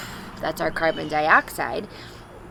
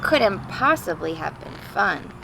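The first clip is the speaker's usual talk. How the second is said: put-on clear, louder reading-to-children voice; storybook read-aloud; 3 semitones higher, 5 dB louder than usual. Teacher reading a picture book to the class, storybook voice. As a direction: read it like a father's letter to his kids.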